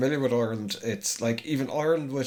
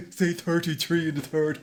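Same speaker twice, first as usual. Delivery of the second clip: mock West Country accent, laughing; imitating a Bristol local, amused; Bristolian accent; 5 semitones higher than usual. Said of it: "What, what accent?".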